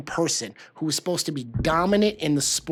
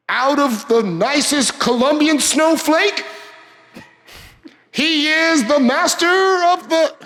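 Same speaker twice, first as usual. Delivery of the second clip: arena announcer; 11 semitones higher, 8 dB louder than usual. Pretend. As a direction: stretch every word for the crowd. Mock main-event fight introduction.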